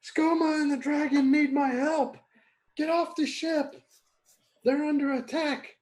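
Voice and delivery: shout. Acted. Player warning the imps of an attack, high sustained shout. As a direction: holler a warning from far above, high and sustained. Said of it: "I'm gonna shout to the imps".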